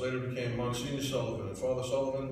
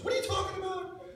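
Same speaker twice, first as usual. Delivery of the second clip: very high excited squeal; high-pitched mock shout of an excited coach; voice up octaves. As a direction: shoot up into a squeaky excited yell. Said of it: "his voice went up a couple of octaves".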